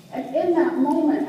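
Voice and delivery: normal talking voice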